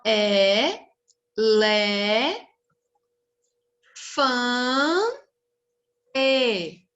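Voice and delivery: é, lé, fã, e as isolated, held syllables; long pauses between é, lé, fã and e. Shown as a demonstration. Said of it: "Vamos separar aqui?".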